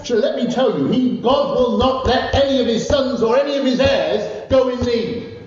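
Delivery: high, emphatic sermon climax; impassioned preaching. Real, not acted. Preacher assuring the congregation of God's provision, impassioned preaching. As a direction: preach it with rising conviction, pitched high.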